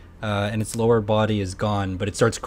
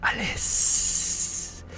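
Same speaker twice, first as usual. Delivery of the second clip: high, screechy, effeminate creature voice; tinny voice; 7 semitones higher, much slower than usual. Acted. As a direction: shriek the name in a high tinny wail. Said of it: "in an effeminate but screechy, kinda high-pitched, t-, uh, tinny voice".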